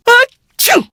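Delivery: big high sudden sneeze. Acted. Librarian sneezing mid-conversation, loud high sneeze. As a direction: let a loud sneeze burst out. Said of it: "Viewed Zadorov".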